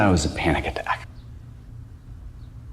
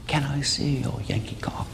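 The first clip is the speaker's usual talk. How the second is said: higher, softer British girl impression; British accent; 6 semitones higher, 6 dB quieter than usual. British girl propositioning the comic in an alley, British accent. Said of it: "how can I say no to that accent?".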